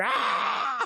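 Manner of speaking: high mock monster scream; monster roar. Playing the monster the old lady saw through the window, high yelp.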